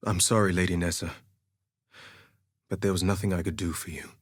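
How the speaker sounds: low, quick, subdued apology; regretful apology. Acted. Duelist apologises to fallen lady.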